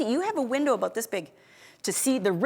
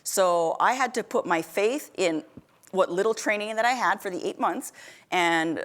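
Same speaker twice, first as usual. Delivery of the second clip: usual speaking voice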